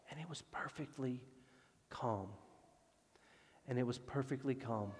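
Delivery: soft, hushed telling; quietly settling after the storm; hushed calm. A narrator's reading. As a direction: let the voice drop away into stillness.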